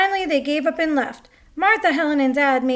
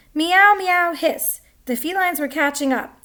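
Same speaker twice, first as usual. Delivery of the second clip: ordinary delivery, nothing marked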